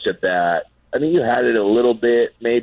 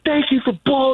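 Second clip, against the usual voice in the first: a hint of Mota, pitched much higher; mock Mota reply. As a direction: toss it off in a raised mock voice. Imitating Mota's reply.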